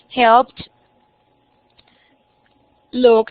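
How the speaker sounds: helped and looked said loud, ending in a clipped T; model words. Shown as a demonstration. Teacher models the T ending for Angie, loud. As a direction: punch the final T of helped, looked. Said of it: "suena como T".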